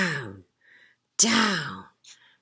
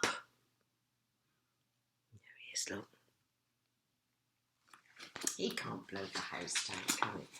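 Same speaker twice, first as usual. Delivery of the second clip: whisper; 11 semitones lower, 16 dB quieter, much faster than usual. Real, not acted. Whispering to child.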